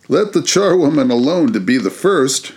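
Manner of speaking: high, loud cockney charwoman; charwoman's cry. A narrator's reading. Charwoman crowing to old Joe, high and loud. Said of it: "cried she".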